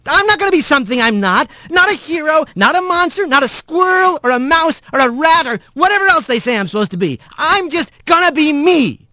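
high-pitched, racing angry rant; Rat, defiant. Acted. Rat refuses the roles others give him, high and defiant. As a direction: pile up the denials, high and heated.

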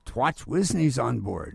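mock urgent warning, drawn out; retold garbled repeat. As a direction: insist on the muddled message. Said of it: "He said".

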